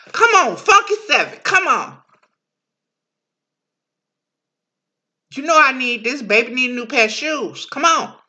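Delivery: high, loud, pleading gambler's call; gambler at dice. Acted. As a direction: beg the dice for a win. Acting a gambler rolling dice.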